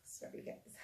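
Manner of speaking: a near-silent, tearful sorry; choked up, apologizing. Real, not acted. Apologizing to viewers while overcome with tears. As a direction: swallow the tears and barely get it out. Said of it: "I'm not crying because I'm sad".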